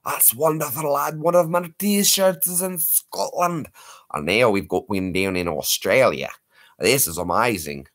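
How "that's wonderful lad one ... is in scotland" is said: put-on Scottish accent. Scottish voice continues.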